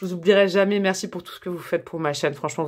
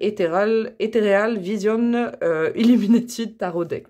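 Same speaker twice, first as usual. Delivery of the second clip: slow, halting English with a French accent; slower than usual. Real, not acted. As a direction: stumble through English with French sounds. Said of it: "mon accent anglais". (anglais hésitant à l'accent français)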